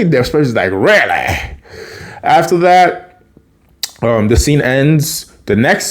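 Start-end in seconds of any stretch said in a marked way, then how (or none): 0.7-1.5 lower, falling, silly "really"; goofy Spencer echo. Retold or imitated. Spencer answers Carly's really, goofy and low. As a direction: echo it back low and hammed-up. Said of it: "that was a little goofy".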